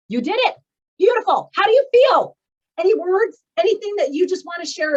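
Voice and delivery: thrilled cheerleading praise; excited praise. Real, not acted. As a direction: gush over their achievement. Host praising a group's achievement.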